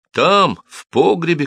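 high, drawn-out shout. A narrator's reading. Cobbler directing guards to the cellar, shouting. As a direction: shout it slowly and high to point them there. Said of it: "кричал Симон".

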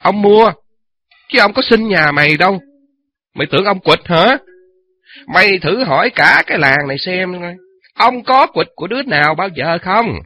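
loud, high angry threat; bully her with a loud angry voice. Acted. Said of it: "hầm hầm, chĩa vào mặt mụ".

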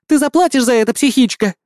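high, shrill parting threat; fleeing shout. A narrator's reading. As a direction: yell it while running away. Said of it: "с криком".

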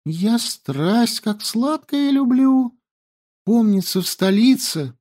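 put-on high, lively young girl; girl's voice. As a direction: chatter high and breathless.

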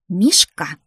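loud, sharp grandmotherly rebuke; scolding. A narrator's reading. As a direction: call out the name as a telling-off. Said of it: "ругнулась бабуля". (ругаясь, громко одёргивая)

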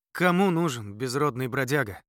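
plain reading voice

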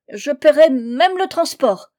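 high, loud eager offer; desperate promise. A narrator's reading. Trapped man promises Fanta payment, desperately.

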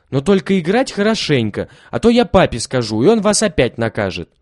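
stern warning. A narrator's reading. Lilya warns her brothers she'll tell papa, loudly. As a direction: warn them you'll tell papa.

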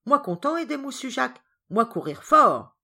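high, loud, delighted character voice; eager servant Ram. Acted. Ram happy to help Jacques catch the others, eager. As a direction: burst out gladly, stretching the last words.

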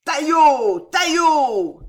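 very high, loud, drawn-out yell; wild rallying whoop; howled hunting cry. A narrator's reading. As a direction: howl it out like a pack in full chase. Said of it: "brisait et hurlait".

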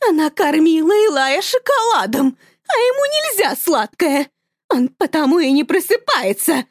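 mother snapping at husband. Acted. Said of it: "огрызается мама". (огрызаясь, высоко)

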